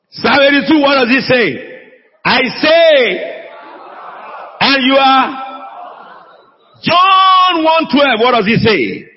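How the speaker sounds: high, impassioned preacher's cry; full-force preaching. Real, not acted. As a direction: proclaim it to the congregation with rising intensity.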